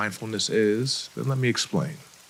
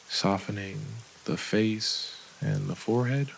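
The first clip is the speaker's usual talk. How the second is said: unhurried guide with long pauses between phrases; guided meditation; 5 dB quieter than usual. Acted. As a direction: lead them gently through each breath, softening as the body relaxes. Leads a breathing and body scan.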